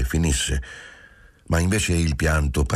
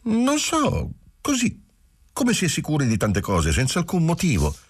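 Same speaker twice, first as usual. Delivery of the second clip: put-on higher young woman's voice, rushing her confession; Giulia, young wife, anxious; 12 semitones higher than usual. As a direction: pour it out, half tearful, half relieved. Giulia answers lightly. (giovane moglie che confessa, voce alta e agitata)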